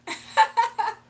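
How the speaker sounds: loud, high burst of laughter. Real, not acted. Streamer cracks up at a friend's voice clip, bursting laugh. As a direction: crack up loudly.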